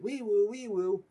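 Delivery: high, loud, slow wee-woo siren; spoken siren noise, stretched out; imitated siren. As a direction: wail it like a police siren. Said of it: "what sounds like sirens".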